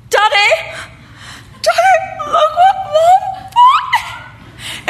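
high, loud mock wail; hammed-up daughter crying out for Daddy; upset daughter. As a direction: wail to Dad in a drawn-out squeal. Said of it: "and she's like".

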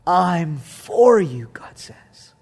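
slow breathy whisper. Real, not acted. Preacher whispering God's promise to the church, slow. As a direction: whisper it slowly, intimately. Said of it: "whispered repetition".

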